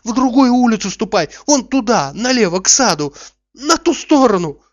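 loud, high-pitched directions; urgent ordering away. A narrator's reading. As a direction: shoo him off down the road, insistently. Master orders servant away down street.

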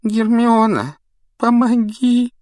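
high desperate wail for help; begging in terror; panicked plea. Acted. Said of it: "простонал".

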